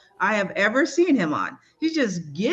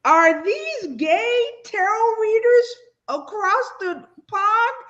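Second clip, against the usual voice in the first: amazed; 10 semitones higher, 3 dB louder, slower than usual. Retold or imitated. Quoting her own amazed reaction.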